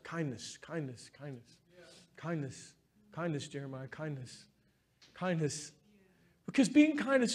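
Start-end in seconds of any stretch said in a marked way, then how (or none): 0.0-5.7 murmured to himself, much softer and lower; quiet self-reminder. Retold or imitated. Pastor repeating his inner reminder to himself, hushed and low. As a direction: softly chant it to yourself like a private mantra. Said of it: "I'm always like".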